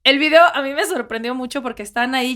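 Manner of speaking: normal talking voice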